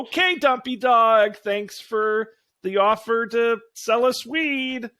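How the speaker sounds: high, slow, uneasy brush-off; nervous kid. Acted. Philip edges his sister away from the mascot, high and uneasy.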